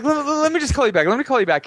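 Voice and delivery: high-pitched, stammered excuse; distracted brush-off. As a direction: imitate fobbing her off on the phone. Brushing off girlfriend while gaming.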